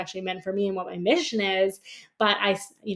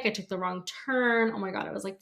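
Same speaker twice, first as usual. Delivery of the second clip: ordinary talk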